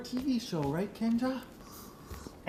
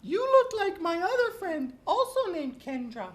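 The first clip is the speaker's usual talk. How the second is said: high, playful puppet voice; Mister Eyeballs puppet greeting Kendra; 10 semitones higher than usual. Pretend. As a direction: chat as a silly puppet.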